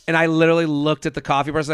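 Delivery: ordinary talk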